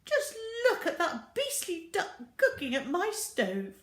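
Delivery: high, loud, indignant; outraged cry. Acted. Missus Gregg flies past the window, high outraged cry. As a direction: scorn the duck at your stove. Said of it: "cried Missus Gregg".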